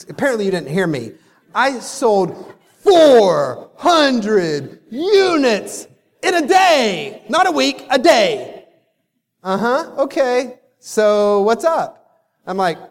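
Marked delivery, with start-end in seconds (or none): 0.1-8.7 higher, louder, slowed insistence; frustrated brag, pitched up and loud; exasperated insisting. Retold or imitated. Insisting on his achievement. 9.4-11.9 unimpressed. Retold or imitated. Kaya unimpressed.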